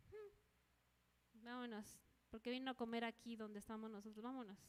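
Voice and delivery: low, muted grumble after a hmm; disdainful snub. Acted. Believer avoiding an enemy at a meal, disdainful snub. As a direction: act out a huffy aside, keeping it down. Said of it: "O así de".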